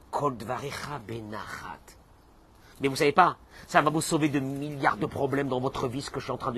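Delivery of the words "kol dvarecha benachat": unvoiced hush; the teacher whispers the verse on gentle speech. Shown as a demonstration. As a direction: breathe the verse like a secret.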